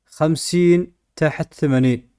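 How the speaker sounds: ordinary talk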